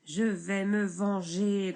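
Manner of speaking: grondé lentement, plus fort; grondement. Acted. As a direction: menace sourde, lentement. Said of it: "gronde le bûcheron". (le bûcheron gronde sa vengeance)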